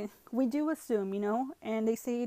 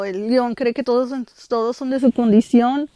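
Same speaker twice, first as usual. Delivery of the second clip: proverb said out loud with force, much louder; emphatic proverb; 2 semitones higher, 13 dB louder than usual. Retold or imitated. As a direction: press the saying home, loud and pointed.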